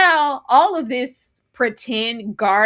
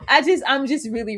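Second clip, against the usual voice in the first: ordinary talk, nothing marked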